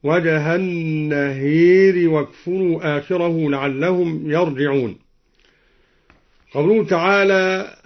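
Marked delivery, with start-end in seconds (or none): 0.0-5.0 tilt the long vowel of النهير towards e; imala on النهير. Shown as a demonstration. Teacher reciting al-Duri's reading, النهير with imala. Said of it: "وكذا أمال النهار".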